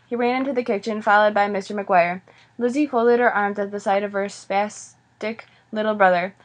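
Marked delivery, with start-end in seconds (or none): none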